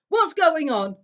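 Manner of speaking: high, loud furious demand; angry uncle. A narrator's reading. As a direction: demand answers in rage. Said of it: "growled Uncle Vernon".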